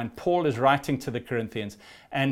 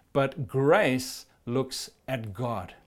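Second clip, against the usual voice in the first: slow, weighty "grace" leading the line; drawn-out emphatic "grace"; stress on grace; much slower than usual. Shown as a demonstration. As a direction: lean hard on "grace", slowly. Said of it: "preacher stresses grace".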